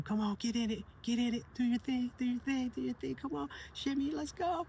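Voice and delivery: put-on high, pushy kid egging a friend on; a boy shaking his friend by the shoulder, urging him to dance, high and hyped. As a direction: pitch way up and push him to dance. Sebastian shaking Mateo to dance.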